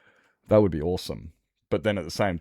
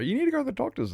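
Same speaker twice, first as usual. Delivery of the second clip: much higher nagging mock voice; mocking people; much faster than usual. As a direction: parody the advice he gets. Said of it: "People say".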